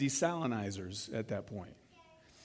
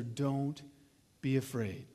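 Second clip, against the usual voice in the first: soft reassurance; 4 semitones lower, 6 dB quieter, slower than usual. Retold or imitated. Elijah comforting the starving widow.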